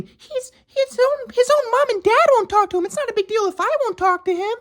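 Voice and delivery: high falsetto, dismissive; imagine her thoughts in a high put-on voice; mocking his ex. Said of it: "she's probably thinking".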